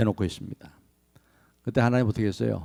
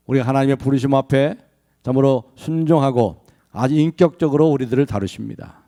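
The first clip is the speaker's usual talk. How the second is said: ordinary talk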